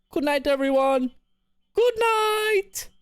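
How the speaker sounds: very high, drawn-out goodnight; playful falsetto farewell; high-pitched sign-off. Pretend. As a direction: sing out goodnight in a squeaky falsetto. Theatrical sign-off to the chat.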